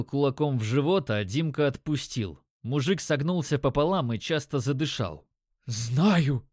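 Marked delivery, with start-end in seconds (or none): 5.7-6.4 strained, winded croak; gasping rasp. A narrator's reading. Punched dealer gasping for breath, rasping an answer. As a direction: force it out while fighting for air. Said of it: "прохрипел он, хватая ртом воздух".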